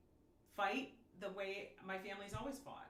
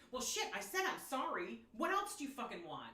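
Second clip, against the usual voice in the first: exasperated, raised and higher, snapping back; mock angry fight quote; 4 semitones higher, 5 dB louder than usual. Partner yelling back in a fight, mock angry. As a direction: throw it back like a fed-up partner mid-argument. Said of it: "when you hear them fighting".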